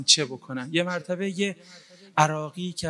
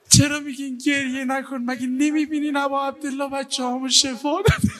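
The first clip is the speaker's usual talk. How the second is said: higher, louder, half laughing through tears; tearful cry of joy; 9 semitones higher, 4 dB louder than usual. Retold or imitated. Mother to the crowd, higher and louder, laughing through tears. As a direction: cry it out, overwhelmed with gratitude. Woman answers those calming her.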